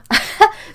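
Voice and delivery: sudden high giggle; high laugh. Real, not acted. Laughing at the name Slough, high giggle. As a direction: laugh at the spelling.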